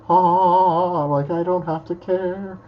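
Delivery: high, drawn-out dawning realization; acted revelation. Retelling the moment the sky opened up, awed relief. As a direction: let it open out slowly like the sky parting.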